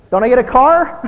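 mock child angling for a car; hopeful kid. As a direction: ask like a greedy teenager. Voicing child after homework.

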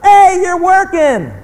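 slow, high, loud retort; loud rebuke. Acted. Preacher to someone moaning about work, loud rebuke. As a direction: holler it to snap the moaner out of it.

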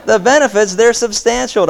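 bright high countering with a gleam. Retold or imitated. Pastor countering the businessman's boast with a gleam. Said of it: "I countered with a gleam".

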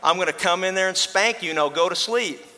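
a hint of a stern father warning; dad yelling. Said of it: "This time the dad yells back".

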